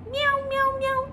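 high meowing kitten; cat meow. Retold or imitated. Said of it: "un gatico estaba en la calle". (maullido agudo de gatito)

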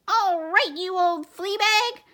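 high, loud squeak of a bossy mouse officer; squeaky mouse voice. Acted. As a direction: squeak it like a tiny commander. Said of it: "squeaked the Big Cheese".

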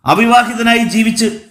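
high-pitched, aggrieved outburst; agitated protest. A narrator's reading. Man asks uncle to understand his state, agitated. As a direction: plead your own case heatedly.

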